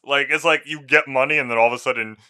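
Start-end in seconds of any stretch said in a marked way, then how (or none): none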